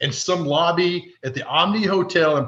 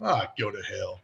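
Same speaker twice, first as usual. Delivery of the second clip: muttered low and much softer; under his breath; 6 semitones lower, 10 dB quieter than usual. Retold or imitated. Mike Keith cursing at taunting fans under his breath. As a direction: grumble it under your breath. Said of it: "under his breath be like".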